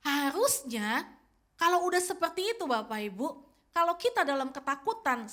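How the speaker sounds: ordinary delivery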